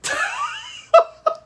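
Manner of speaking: high squeal of a laugh; real high-pitched laughter. Real, not acted. Laughing at Fred.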